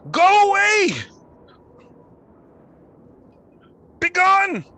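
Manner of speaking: high, very loud shriek; frightened scream. Acted. Ash-covered man with a broom warding off strangers, screaming. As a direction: scream to drive the threat off. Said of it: "he screams".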